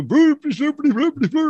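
high, silly mock Swedish chef gibberish; hammed-up Swedish chef nonsense; Swedish chef impression. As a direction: babble like the Muppet Swedish chef. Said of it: "the Swedish chef".

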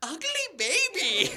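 put-on high, slow old lady sneer; grandma's verdict. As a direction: drop the verdict slowly. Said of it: "she'd look at me and go".

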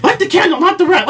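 movie quote shout. Retold or imitated. Quoting the movie's shouted line.

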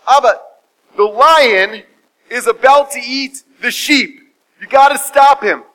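high, loud, emphatic plea; urgent alarm. Acted. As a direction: sound the alarm as a panicked son, acted. Son reports danger to father.

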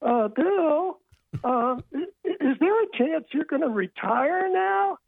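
imitating own voice rising with nerves; hesitant, high-pitched timid question; nervous high voice. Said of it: "my voice goes up and I go".

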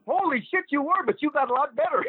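very high, amused mock exclamation; Emile Dupre. Emile teasing Rip about his old work, mock amazed. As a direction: imitate him squeakily high, close to laughing. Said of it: "And he goes".

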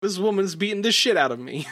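laughing. Real, not acted. Laughing while speaking.

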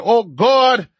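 high-pitched mock West Indian lilt; Trinidadian accent. Host imitating people in Trinidad facing floods, Trinidadian accent. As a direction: exclaim it in a playful Trinidadian accent. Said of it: "Trinidadian West Indian accent".

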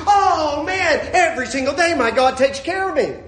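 loud, high-pitched joyful exclamation; exultant. Acted. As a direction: proclaim it, bursting with joy. Acted exultation, then repeated softly.

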